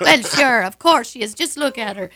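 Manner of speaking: loud, emphatic Irish brogue; Irish accent. Retold or imitated. Irish locals vouching for their visiting queen, loud Irish accent. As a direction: proclaim it loudly with an Irish lilt. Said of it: "And the Irish were like".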